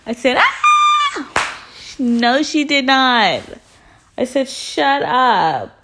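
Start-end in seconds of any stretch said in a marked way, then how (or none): 0.4-1.7 very high-pitched reenacted scream. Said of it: "and I screamed". 2.0-3.4 high, slow, drawn-out disbelief; scandalized disbelief. Retold or imitated. Reenacted disbelief after screaming. 4.5-5.8 higher-pitched, stunned exclamation; mock disbelief. Reenacted shock at the insult.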